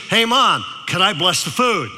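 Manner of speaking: put-on loud eager kid's call. Loud kid asks mom to bless food, loud and eager. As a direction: belt it out like an eager boy. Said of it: "He goes".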